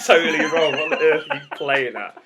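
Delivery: amused scolding through laughter; laughing, incredulous tease; laughing disbelief. Real, not acted. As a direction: tell them off while cracking up. Laughing at the silly answer.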